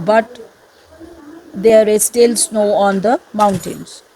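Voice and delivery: slow, careful reading of the textbook; slow reading aloud. Acted. Teacher reading the exercise to students, slow and deliberate. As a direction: read each phrase out slowly for the class.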